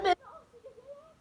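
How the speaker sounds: high, fast disgust. Real, not acted. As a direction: recoil from the taste. Disgust after tasting.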